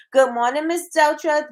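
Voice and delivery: high lifted hello; cheery greeting. Real, not acted. Host welcoming Big Meech, excited high call. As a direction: call out the viewer's name happily.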